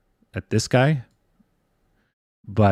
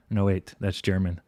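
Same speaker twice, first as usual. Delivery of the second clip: ordinary delivery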